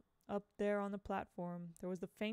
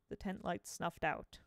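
ordinary delivery, nothing marked

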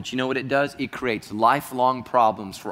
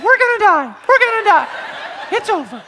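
put-on much higher, louder little-girl squeal; terrified little girl; 9 dB louder than usual. The pastor's inner six-year-old girl panicking at the shark, squealing. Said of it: "my inner soul voice is a six-year-old girl".

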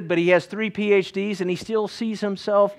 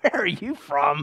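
lower-pitched mock bewilderment; incredulous question; 4 semitones lower, 3 dB quieter than usual. Pennsylvanians reacting to his accent, mock incredulous. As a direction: draw it out as a puzzled local. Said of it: "and they're like".

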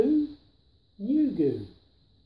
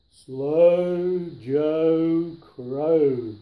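drawn-out slow crow voice, louder; 9 dB louder, much slower than usual. A narrator's reading. Reading Slow Joe Crow's entrance to the listener, very slow and loud. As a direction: stretch each word like a lumbering crow.

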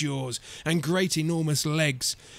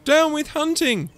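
high, loud hooted slogan; hooting; 11 semitones higher, 5 dB louder than usual. A narrator's reading. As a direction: hoot it out in protest. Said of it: "For he hooted".

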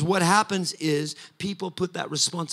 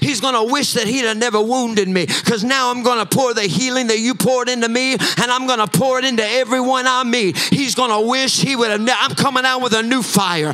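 louder and higher, chanting, building preaching; preaching at full force; 5 semitones higher, 8 dB louder than usual. Acted. Pastor declaring victory over the enemy to the church, full-force preaching. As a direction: drive each "coming out" line harder, near song.

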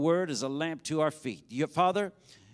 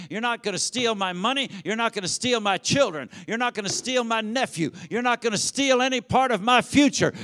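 rising into a loud, higher shout; building rebuke; 4 semitones higher, 4 dB louder than usual. Real, not acted. Preacher telling off the devil, building to a shout. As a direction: rebuke the devil harder each line.

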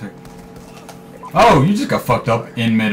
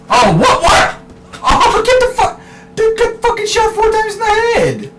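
disbelieving shout; 5 dB louder than usual. Real, not acted. Unbelievable headshot kill.

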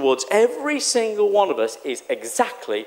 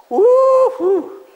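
a high, loud woo-hoo; excited whoop; 13 semitones higher, 5 dB louder than usual. Real, not acted. Preacher whoops at the congregation, excited. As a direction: whoop with real joy. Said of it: "I'm getting excited".

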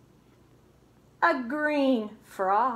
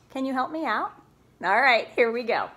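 plain reading voice

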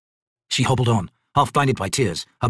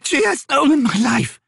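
high, louder, slow anguished cry; anguished scream; 5 dB louder, slower than usual. Acted. Old Maibi screaming her loss to the raven Crone. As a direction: wail it out in anguish. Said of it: "the Maibi screamed".

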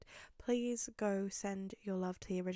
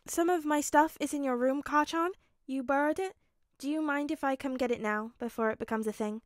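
higher placating question, settling down; tentative request; 5 semitones higher, 6 dB louder than usual. Acted. Midoriya asking Bakugo for his stuff back, tentative. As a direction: ask carefully in a raised pitch.

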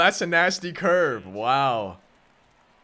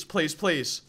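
normal talking voice

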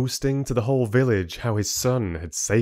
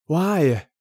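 hissed; 8 semitones higher, 4 dB louder than usual. Acted. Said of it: "Alec hissed at his back".

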